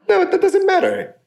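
high, brushing the objection off; dismissive attitude. Retold or imitated. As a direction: wave it away as nothing. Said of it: "That should be really the attitude".

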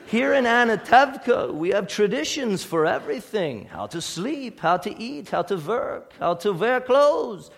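lightly voiced Tevye with a Yiddish accent. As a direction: play Tevye proudly explaining the traditions. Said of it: "I'm gonna do the accent".

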